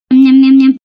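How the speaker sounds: loud, eager munching noises; hungry chewing sounds. Acted. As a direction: smack the lips as if starving. Eating dosa hungrily. (ஆசையாக மென்று சாப்பிடும் சத்தம்)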